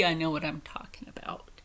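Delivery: a fast, unvoiced hiss under the breath; hushed snap back; whispered retort. Real, not acted. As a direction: mutter it quick and low.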